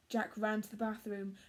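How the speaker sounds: usual speaking voice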